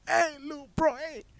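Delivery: high-pitched mock greeting; playful calling out. Sneaking up behind his little brother, playfully calling out high. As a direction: put on a very high voice to hail someone.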